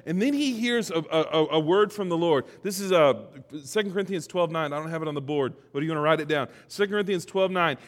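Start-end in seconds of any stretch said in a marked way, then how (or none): none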